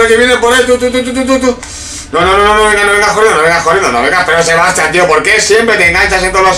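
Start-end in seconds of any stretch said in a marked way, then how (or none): none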